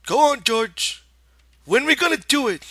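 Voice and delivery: put-on high, loud childlike giant; Lenny's voice. A narrator's reading. Lenny's voice in the story.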